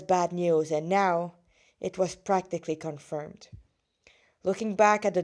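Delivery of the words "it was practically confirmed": breathy whisper for the ominous reveal; whispered narration. A narrator's reading. Narrator reading her story, hushing the grim news into a whisper. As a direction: drop to a whisper on the confirmation.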